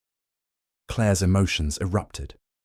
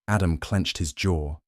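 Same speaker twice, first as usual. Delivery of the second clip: ordinary delivery, nothing marked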